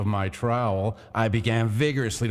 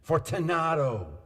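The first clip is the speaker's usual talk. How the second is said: called name, pitched up; calling out; 7 semitones higher than usual. A narrator's reading. Montresor calling into the niche, raised pitch. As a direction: call into the niche. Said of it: "I called again".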